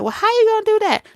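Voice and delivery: high, quick skeptical woman voice; mocking woman. Woman doubting his promise, in a high quick skeptical voice. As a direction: pitch it way up and rush the doubt. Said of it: "they be like".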